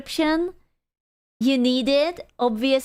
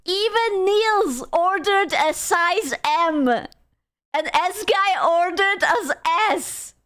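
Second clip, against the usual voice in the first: higher and slower, incredulous; laughing outrage; 6 semitones higher, slower than usual. Real, not acted. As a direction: hammer out the disbelief at Niels. Mocking Niels' size.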